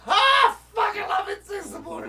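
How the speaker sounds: high, loud, delighted yell; thrilled shout. Real, not acted. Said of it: "delighted outburst".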